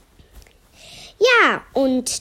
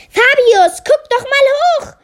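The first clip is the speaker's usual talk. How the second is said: shouting; 11 semitones higher, 5 dB louder than usual. Acted. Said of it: "schrie Melia".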